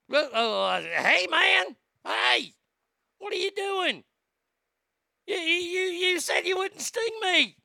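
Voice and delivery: high betrayed yelp of a frog; stung frog. Acted. Stung frog crying out at the scorpion, high and panicked. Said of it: "frog stung mid-swim".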